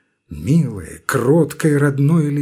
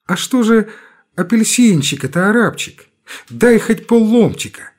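put-on high, pleading boy; 8 semitones higher, 4 dB louder than usual. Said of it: "опять слышится молящий голос".

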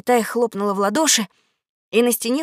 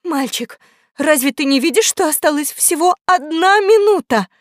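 pleading question climbing higher and louder; tearful; 5 semitones higher, 4 dB louder than usual. Acted. Question mark warns of last minute, tearful. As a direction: ask as if through tears, building in urgency. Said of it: "сквозь слёзы спрашивал вопросительный".

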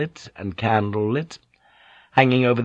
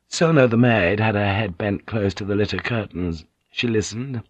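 usual speaking voice

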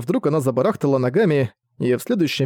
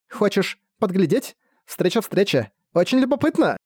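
playful fairy voice; 6 semitones higher than usual. Acted. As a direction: tease like a prankish little fairy. Mischievous fairies Chichi speak.

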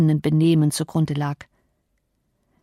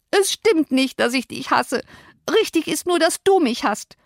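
much higher, distressed protest; wailing; 9 semitones higher than usual. Acted. Cousin overhears and Cathy protests, wailing. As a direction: wail it out in hurt protest. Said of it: "heulte Cathy auf".

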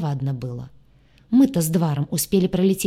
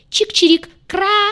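high, loud bird chirping; squawking birds; 13 semitones higher, 6 dB louder, slower than usual. Acted. Birds screech over the chase, high and loud. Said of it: "верещали поклоны".

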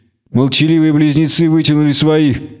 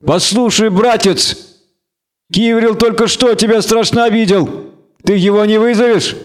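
higher-pitched, mock-friendly needling; goading gang leader; 8 semitones higher than usual. A narrator's reading. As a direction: egg the stranger on.

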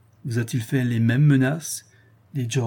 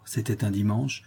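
ordinary delivery, nothing marked

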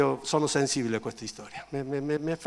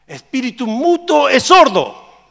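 loud, high, slow command; drawn-out shouted address; stern rebuke; 12 semitones higher, 18 dB louder, slower than usual. Acted. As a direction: rebuke the spirit forcefully. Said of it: "e sgridò allo spirito immondo".

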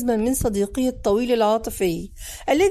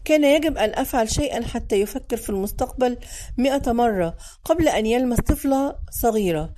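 ordinary delivery, nothing marked

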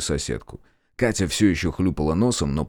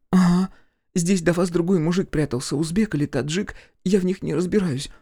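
put-on high-pitched voice of a lost girl; distressed girl; 10 semitones higher than usual. A narrator's reading. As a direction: voice a homeless girl, pitched well up. Narrator voices the girl Катя. (высокий голос растерянной девушки)

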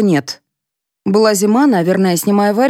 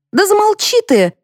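very high, angry dismissal; exasperated shut-up. A narrator's reading. Mother silencing her mother-in-law, exasperated. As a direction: snap back in a high-pitched voice.

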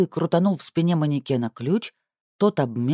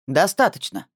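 commanding; 3 semitones higher than usual. Acted. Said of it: "раздался властный голос".

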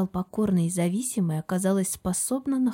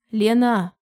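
louder, firm cut-in on her name; steely interruption; 3 semitones higher, 6 dB louder than usual. A narrator's reading. Husband interrupting his wife's friend on the phone, steely. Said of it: "в голосе прозвучала сталь".

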